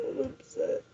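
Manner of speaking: breathless, barely voiced whisper; whispered upset. Real, not acted. Repeating her feelings to viewers, whispered. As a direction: drop to a whisper.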